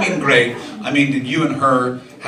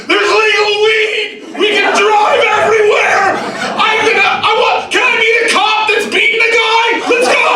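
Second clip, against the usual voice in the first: amped-up newcomers; 3 dB louder than usual. Retold or imitated. Newcomers arriving in California, yelling in high, loud hype. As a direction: scream it like a wide-eyed tourist gone wild. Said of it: "they're just a bit too amped up".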